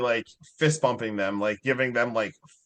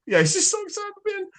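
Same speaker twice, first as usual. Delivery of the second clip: excited; faster than usual. Pretend. Mocking character's giddy excitement.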